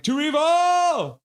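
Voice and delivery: loud, slow, high cheering toast; toast shout. Retold or imitated. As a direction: bellow it out like raising a glass. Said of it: "Zach is like".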